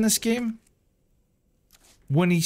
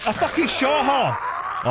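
panicked shout; 6 semitones higher, much slower than usual. Real, not acted. Attacked, shouting.

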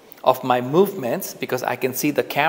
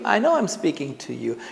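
normal talking voice

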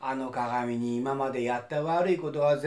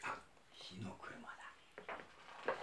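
whispering; 7 semitones lower, 20 dB quieter, much slower than usual. Acted. Frightened realisation.

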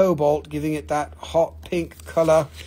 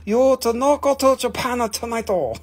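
hammed-up Japanese-or-Chinese accent. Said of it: "This is not Japan, this is China".

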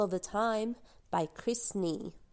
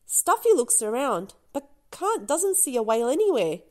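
ordinary delivery, nothing marked

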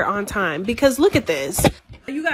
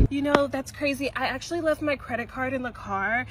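quiet; 9 dB quieter than usual. Acted. Excuse in soft voice.

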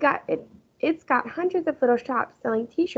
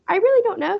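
higher light grandma voice; amused grandma; 10 semitones higher, 3 dB louder, faster than usual. A narrator's reading. Grandma answering Fudge playfully.

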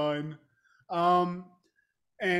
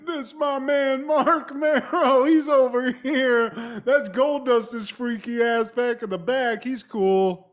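loud, slow, pitched-up hype voice; mock Vader intro; 6 semitones higher, 8 dB louder than usual. Vader introducing the team, loud slow hammed-up hype. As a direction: drag it out like a big showman. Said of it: "and he's like".

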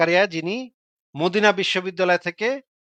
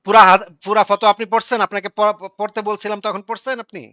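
real irritated shouting over the opponent; angry scolding; 3 semitones higher, 7 dB louder than usual. Real, not acted. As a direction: cut in and berate him, tripping over words. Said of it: "আপনি এভাবে কথা বলতেছেন".

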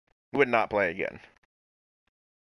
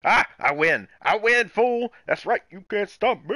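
higher, louder victory yell; triumphant shout; 9 semitones higher, 6 dB louder than usual. Real, not acted. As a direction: gloat at the beaten opponent. Winning tic-tac-toe.

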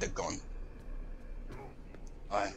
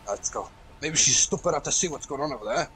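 ordinary talk

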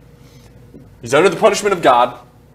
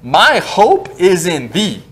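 My hope is in thee, each word drawn out slowly; slow solemn scripture reading; slow, weighty reading; 3 semitones higher, much slower than usual. Shown as a demonstration. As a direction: read it very slowly to give it weight. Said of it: "five words here are important".

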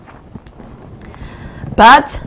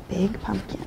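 ordinary delivery, nothing marked

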